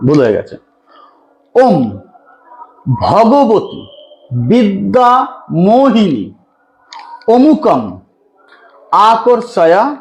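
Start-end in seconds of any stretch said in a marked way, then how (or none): 1.6-10.0 slow, deliberate chant of the mantra; slow dictation chant. Shown as a demonstration. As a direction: drag each syllable out so it can be written down. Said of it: "আমি মন্ত্র খুব ধীরে বলছি".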